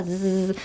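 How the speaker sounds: a soft buzzing Z sound. Shown as a demonstration. Teacher demonstrates the Z sound. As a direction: buzz the Z on your teeth. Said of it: "Zのサウンドの時は".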